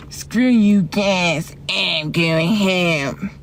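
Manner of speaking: very slow, drawn-out cartoon kid voice; Eric Cartman. Streamer showing how Cartman says he's going home, slow cartoon impression. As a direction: drag every word out in a cartoon impression. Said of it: "That's how he talks".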